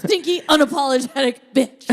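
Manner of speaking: loud laughing jab. Real, not acted. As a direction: crack up while saying it. Laughing at the joke.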